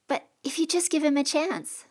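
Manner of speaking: put-on high kid's plea; pleading boy. A narrator's reading. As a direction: beg her, young and hopeful.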